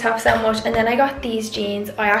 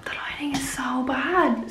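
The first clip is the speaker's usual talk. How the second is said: whispered aside; 2 semitones higher, 6 dB quieter than usual. Real, not acted. Aside about bad lighting.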